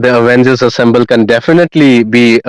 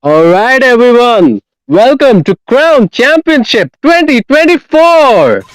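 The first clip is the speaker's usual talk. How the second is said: high, hyped opening to the show; announcer voice; 7 semitones higher than usual. Acted. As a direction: welcome the crowd like a big event host. Caster opening the broadcast.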